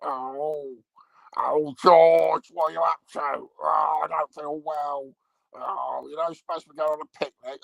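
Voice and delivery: mock Dalek with a kids' puppet voice; Zippy. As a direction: play a daft Dalek who sounds like a puppet. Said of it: "one of 'em going round going".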